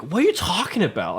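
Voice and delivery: high-pitched retold bewilderment; baffled protest. Student answering accusing professor, acted bafflement.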